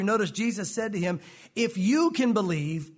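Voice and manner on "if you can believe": slow line with YOU punched; stress on YOU. Real, not acted. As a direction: lean hard on the word "you". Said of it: "I emphasize the word "you".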